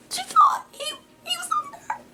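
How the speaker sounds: words squeezed out through high laughter; laughing. Real, not acted. Cracking up telling viewers about Becky's mistake, high laughing voice.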